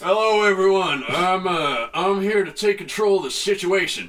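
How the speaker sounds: high, loud mock presidential address. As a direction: play the president taking charge on TV. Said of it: "get on the news and be like".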